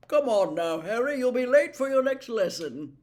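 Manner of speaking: loud, friendly shooing; affably. Acted. Slughorn to Harry, sending him to class, affable. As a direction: genial old professor hurrying him along. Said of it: "said Slughorn affably".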